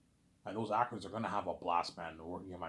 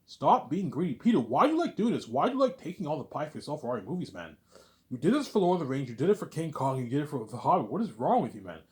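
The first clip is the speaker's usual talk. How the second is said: loud, raised-pitch exasperated telling-off; acted scolding; 4 semitones higher, 6 dB louder than usual. Ivan confronts Peter about taking all the pie, exasperated scolding. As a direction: berate him, voice pushed up high.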